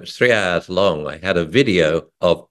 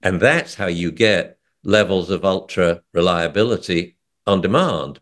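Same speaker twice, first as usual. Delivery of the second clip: normal talking voice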